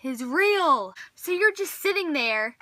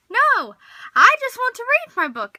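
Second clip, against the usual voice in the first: much higher, louder frustrated cry; exasperated outburst; 10 semitones higher, 9 dB louder than usual. Acted. Reader snapping at a nosy questioner, loud and exasperated. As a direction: burst out in mock exasperation.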